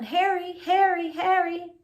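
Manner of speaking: high, loud repeated call. Acted. Family calling their lost dog, high and loud. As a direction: call the dog home from afar.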